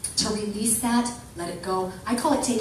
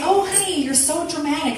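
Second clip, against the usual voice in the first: lightly voiced dad, pitched up; a higher, teasing parental voice; imitating dad; 7 semitones higher than usual. Dad to his dramatic daughter, lightly imitated. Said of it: "My dad used to say".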